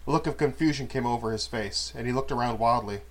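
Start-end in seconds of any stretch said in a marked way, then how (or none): none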